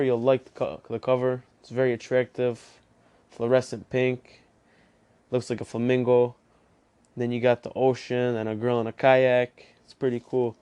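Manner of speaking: normal talking voice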